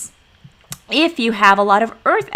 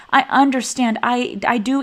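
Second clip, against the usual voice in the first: ordinary talk, nothing marked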